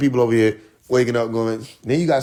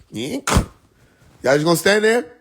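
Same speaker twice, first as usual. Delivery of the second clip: pointed higher question; 5 semitones higher than usual. Retold or imitated. Asking idle people while cleaning.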